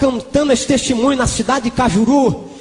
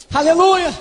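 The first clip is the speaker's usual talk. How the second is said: high, ringing hallelujah; exclaimed praise; 5 semitones higher than usual. Real, not acted. Preacher praising God after praying in tongues, exclaimed praise. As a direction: burst out in high-pitched praise.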